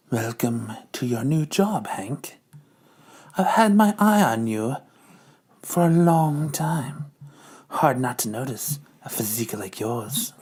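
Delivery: low, soft, slow sultry purr; seductive Madam Hydra. Acted. Madam Hydra flirts with Hank in a low purr. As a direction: seduce him in a hushed low voice. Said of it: "Madam Hydra is talking to Hank".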